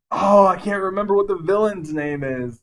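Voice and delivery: ordinary talk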